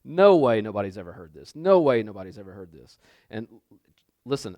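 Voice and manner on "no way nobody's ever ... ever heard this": low, scoffing inner voice; mock skeptical churchgoer; dismissive disbelief. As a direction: scoff under your breath, dragging out "no way". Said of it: "in your mind you're like".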